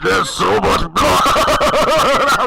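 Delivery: hammed-up loud, drawn-out, laughing scream; screaming in fear. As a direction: scream it slowly, half laughing. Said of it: "this is my screaming voice".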